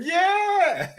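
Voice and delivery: high, drawn-out cheer; mock celebration. Fan reacting to youngster's new contract, hyped-up mock cheer. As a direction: whoop it as if it were huge news.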